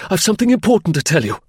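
high, fast, urgent plea; desperate cry. Acted. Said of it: "he cried desperately".

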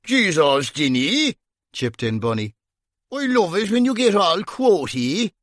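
lightly voiced amused detective; Bunny voice. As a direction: tease him gently. Bunny chipping in.

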